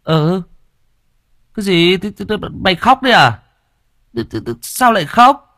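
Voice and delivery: high, astonished stammer; startled surprise. A narrator's reading. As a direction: gasp it out in shock. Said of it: "Yên kinh ngạc". (ngạc nhiên sửng sốt)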